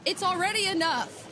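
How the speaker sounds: excited. Real, not acted. Co-caster celebrating.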